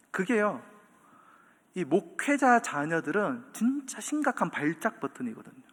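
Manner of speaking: normal talking voice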